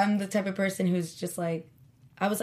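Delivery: ordinary talk, nothing marked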